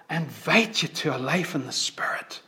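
whispered, all breath; whispered invitation. Real, not acted. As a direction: invite them in a hushed whisper. Altar call whispered.

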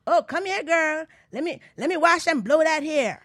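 high, loud call across the mall; salon lady. Retold or imitated. Retelling the Jamaican salon lady calling her over, high and loud. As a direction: beckon her over like a pushy stylist. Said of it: "And she said".

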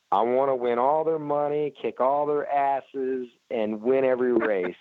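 imitating Kenny's Southern Texas accent, pumped to win. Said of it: "he's got that Southern accent".

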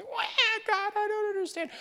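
very high mock wail; exaggerated infant whine to God; whining baby. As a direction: bawl it like a little baby. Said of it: "like little babies in the arms of God".

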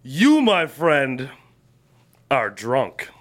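slow, drawn-out tipsy declaration dropping low; drunken pronouncement. Acted. Drinker informing a friend at the bar, slow and tipsy. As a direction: draw it out with mock gravity, pausing midway.

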